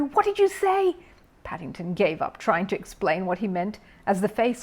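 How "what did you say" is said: high-pitched furious bellow. A narrator's reading. Neighbour bellowing at the bear, furious. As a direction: bellow the question. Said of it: "bellowed Mister Curry".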